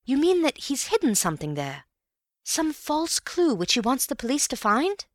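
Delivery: a keen friend guessing aloud; eager Bill. A narrator's reading. Bill voiced, eager questions.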